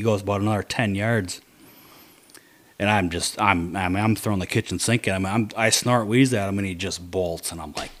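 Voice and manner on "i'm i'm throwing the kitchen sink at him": ordinary talk, nothing marked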